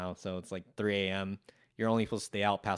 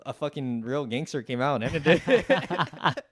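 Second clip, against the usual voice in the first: laughing; 5 semitones higher, 5 dB louder, slower than usual. Real, not acted. Laughing while telling fight's end.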